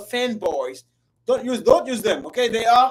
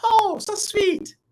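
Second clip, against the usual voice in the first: put-on high, gushing girlish voice; happy Lilia; 8 semitones higher than usual. Teacher voicing happy Lilia, high and sweet. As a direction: coo it like a girl in love. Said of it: "Lilia is happy. She says".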